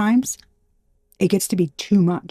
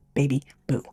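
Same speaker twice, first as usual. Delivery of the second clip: ordinary delivery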